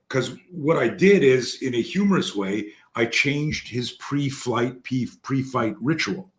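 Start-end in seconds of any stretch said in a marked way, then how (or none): none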